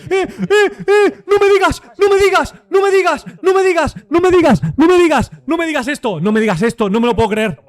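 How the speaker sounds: very high, loud frantic screaming; real shocked disbelief. Real, not acted. Streamer reacting live to the update, shrieking in shock. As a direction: scream it over and over in stunned disbelief. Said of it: "yo me quedé completamente loco porque no me lo esperaba".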